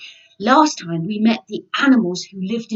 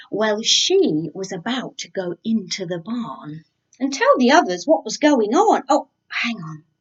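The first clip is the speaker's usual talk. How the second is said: plain reading voice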